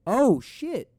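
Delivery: loud, high burst of surprise; startled real exclamation, pitch shooting up; excited shock. Real, not acted. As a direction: cry out at the shocking play. Reacting to a match on screen.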